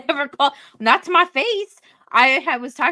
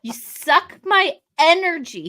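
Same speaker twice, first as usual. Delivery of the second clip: slow, loud teasing accusation; mock accusation; 7 semitones higher, 6 dB louder, slower than usual. Wife teasing her husband, slow and loud mock accusation. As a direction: drag it out to tease him.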